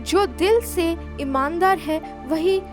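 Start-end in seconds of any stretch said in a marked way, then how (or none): none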